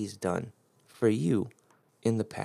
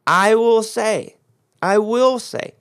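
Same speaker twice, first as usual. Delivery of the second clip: I will say, louder and higher with WILL stressed; preacher booming I will say with stress on will; emphatic repeat; 7 semitones higher, 6 dB louder, slower than usual. Shown as a demonstration. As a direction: preach it out, hitting WILL each time. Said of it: "this is very important in the English".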